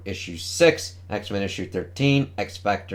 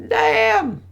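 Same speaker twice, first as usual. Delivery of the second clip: much higher, squealed damn; excited exclamation; 5 dB louder than usual. Real, not acted. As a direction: yelp it out in delight. Reacting to gory comic panel.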